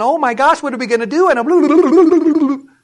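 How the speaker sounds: high, loud panicky babble; hammed-up fretting that spills into blabbering; mock griping. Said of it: "through our griping and complaining".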